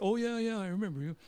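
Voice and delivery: high, bright "oh yeah yeah"; phony recognition. Retold or imitated. Star pretending on the phone to remember the caller, high. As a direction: fake that you remember him.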